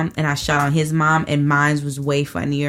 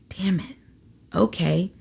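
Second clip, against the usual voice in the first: hushed, whispered curse to oneself; whispered realization; 2 semitones higher, 5 dB quieter than usual. Retold or imitated. Reacting to the book describing him, whispered realization. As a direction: whisper it as a retold private reaction. Quoting her stunned private reaction.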